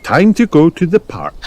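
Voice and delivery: high, sing-out mother voice; high storybook mum; Mummy Pig. A narrator's reading. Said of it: "said Mummy Pig".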